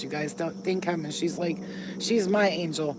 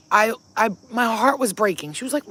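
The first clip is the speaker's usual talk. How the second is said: normal talking voice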